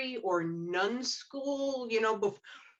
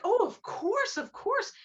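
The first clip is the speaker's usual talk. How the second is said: much higher, bright-eyed chirp; eager Rose; 11 semitones higher, slower than usual. Retold or imitated. Rose cheerfully admitting her wedding night shock, much higher. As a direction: gush it out innocently. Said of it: "She's like".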